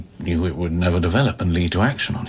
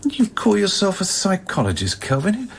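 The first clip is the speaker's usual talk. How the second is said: mocking; 10 semitones higher than usual. Mocking Kelvin's profession.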